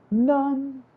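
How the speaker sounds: sudden squeaky falsetto on one word; squeaky high none. Acted. Refusing any opinion, in a sudden high squeak.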